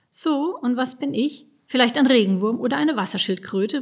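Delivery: ordinary delivery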